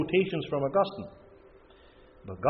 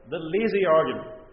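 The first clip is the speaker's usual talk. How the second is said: ordinary delivery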